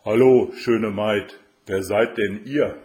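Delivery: loud, drawn-out storybook greeting; crab hailing a maiden. A narrator's reading. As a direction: call out grandly as the enchanted crab. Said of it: "dass eine Stimme zu ihr sprach".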